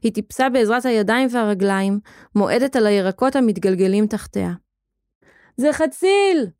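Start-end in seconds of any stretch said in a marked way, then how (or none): 5.6-6.5 high-pitched excited cry; shout. A narrator's reading. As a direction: call it out high like a child. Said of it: "צעק מישהו". (צעקה גבוהה ונרגשת)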